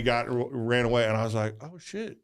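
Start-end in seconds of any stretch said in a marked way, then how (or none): 1.6-2.2 much higher and louder, caught off guard; a sudden, sheepish dawning; surprised realization. Retold or imitated. Dad realizing his mistake.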